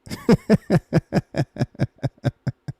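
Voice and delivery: a real burst of laughter; laughing. Real, not acted. Laughing at the clip's claim he was trolling.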